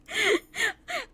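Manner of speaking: shrieking laugh. Real, not acted. Cracking up over Claude.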